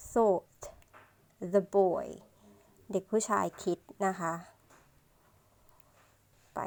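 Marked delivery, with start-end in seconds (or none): 0.1-2.1 put-on "so", pause, then "the boy"; careful re-reading. Shown as a demonstration. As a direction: read it word by word with a long gap after "so". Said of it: "อ่านอีกรอบนึงนะคะ".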